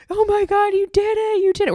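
very high, squealed imitation of husband; screaming and crying. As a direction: mimic an overjoyed partner's scream, loud and very high. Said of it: "We're both, like, screaming".

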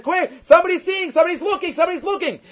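urgent alarm. Retold or imitated. Rabbi urgently warns wagon driver.

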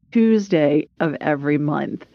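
normal talking voice

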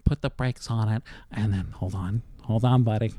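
low mimicry of Mike stalling; teasing Mike by imitating how he talks. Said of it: "That's how I talk?".

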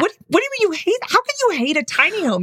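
high, disbelieving question; can't believe what you heard; incredulous. Real, not acted. Disbelief at friend's claim.

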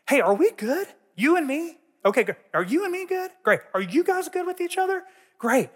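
high, rising questions in quick succession; acted-out check that everyone's okay; eager checking in. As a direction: check in with everyone, pitched well up. Acting out checking in with leaders.

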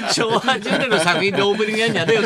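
高めの声で呆れて突っ込む; 曲紹介を繰り返して笑い混じりに文句; 笑いながらのツッコミ. Retold or imitated. 司会者への笑いながらのツッコミ. (笑いながら呆れたツッコミ)

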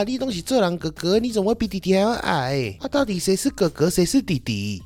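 mock the snide uncle's question; snide old uncle. Imitating the snide uncle questioning a short older brother. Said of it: "那种阿伯啊，很喜欢阴阳怪气".

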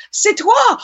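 high, sudden cry of recognition in French; surprised French exclamation. Retold or imitated. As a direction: gasp out "C'est toi!" as if you just recognised him.

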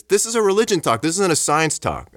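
high-pitched mock protest, not shouted; angry retold doctor. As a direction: imitate an indignant objector. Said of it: "He was, like, angry".

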